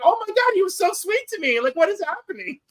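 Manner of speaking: a retold gasp of disbelief, voice jumping way up; mock disbelief. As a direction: squeal it out, baffled and amazed. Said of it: "I was like".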